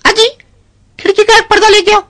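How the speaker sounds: high, sweet wifely voice; wife's voice. Acted. Wife asking her husband for a curtain, high and sweet. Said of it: "बीवी कह रही है अपने शौहर से".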